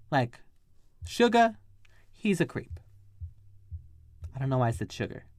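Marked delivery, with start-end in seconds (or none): 1.0-2.8 sassy hint of a Southern accent, pitched high; lightly Southern, high-pitched warning. Real, not acted. Warning a girl he's a creep, lightly Southern. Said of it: "I'm not Southern".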